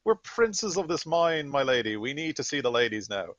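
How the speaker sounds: high, loud, indignant demand; outraged character outburst, easing down towards the end; Voxbox. Acted. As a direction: play a blustering soldier pulling rank, high-pitched and loud. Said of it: "Voxbox is just slamming on the thing and says".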